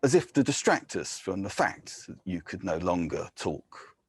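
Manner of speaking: measured, grave poetry reading; poem reading. Acted. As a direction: read it like an elegy at a graveside. Poet reads elegy aloud.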